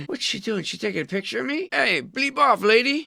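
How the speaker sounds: high-pitched mock outrage building to a yell; mock indignation. Sightseer telling off a lady taking his picture, mock indignant.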